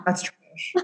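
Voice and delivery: put-on whispered, bored teen dismissal; mock teen mumble. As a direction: breathe it out like a jaded kid. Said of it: "just like our brothers".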